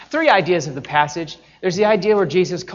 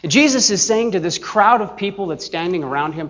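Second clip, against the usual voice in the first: ordinary delivery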